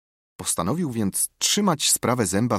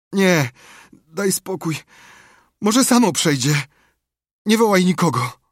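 high, loud martyred moan; feigned suffering; 9 semitones higher, 6 dB louder than usual.

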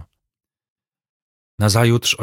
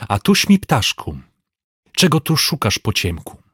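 catching an intruder; 3 semitones higher than usual. A narrator's reading. Servant catches an intruder by the collar.

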